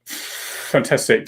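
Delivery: ordinary talk, nothing marked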